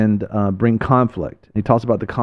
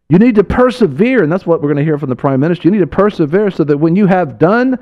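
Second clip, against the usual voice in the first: fiery preaching; 6 semitones higher than usual. Acted. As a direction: preach it to the congregation with fire. Preaching at full force.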